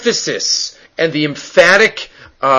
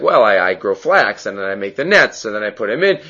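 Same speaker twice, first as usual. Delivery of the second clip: a hint of a simple fisherman, lower and softer; lightly voiced fisherman; 7 semitones lower, 5 dB quieter than usual. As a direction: lightly imitate the fisherman in a low, quiet voice. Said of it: "you're a fisherman?".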